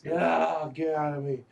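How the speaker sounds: high-pitched retold labor cry; half-hearted mimicked scream while pushing; imitated scream.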